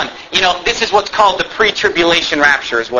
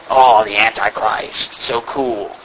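sarcastic; 8 semitones lower, much slower than usual. Acted. Sarcastic jab at the book covers.

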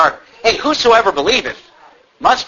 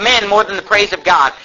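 usual speaking voice

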